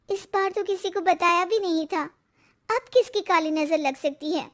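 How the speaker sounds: dejected, suspicious wife. Acted. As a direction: question your husband, pitch lifted with worry. Wife puzzles over bad luck.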